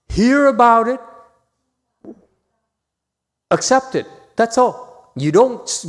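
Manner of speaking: high, loud, driving insistence. Real, not acted. Teacher urging students to stop thinking, loud and insistent. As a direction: push the point home hard.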